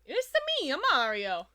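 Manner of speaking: Mario voice. Retold or imitated. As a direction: squeak out a cheery Mario catchphrase.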